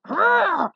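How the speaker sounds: high furious battle cry; angry roar. Acted. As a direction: roar it out in a rage. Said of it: "The firebrand challenged".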